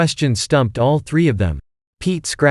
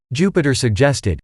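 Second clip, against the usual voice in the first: plain reading voice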